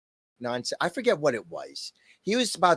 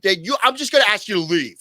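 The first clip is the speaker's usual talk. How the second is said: curt supervisor; 5 semitones higher, 7 dB louder than usual. Retold or imitated. Quoting the angry supervisor.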